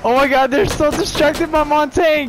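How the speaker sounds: high, loud hype shout; excited yelling. Real, not acted. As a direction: cheer the team on loudly.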